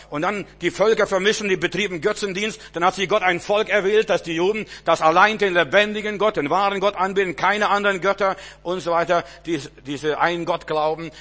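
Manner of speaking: loud, high preacher's proclamation; fervent sermon shout; full-force preaching. Acted. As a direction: drive it home to the congregation.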